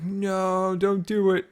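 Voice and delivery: high, drawn-out mock plea; protesting server member. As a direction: imitate someone begging you to stop. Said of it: "somebody on the server is like".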